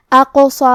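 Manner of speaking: اقصی with bounced, echoing qaf; qalqala. Shown as a demonstration. Teacher demonstrates qalqala to students, bouncing qaf. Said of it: "حرف القاف کو ہلاتے ہوئے".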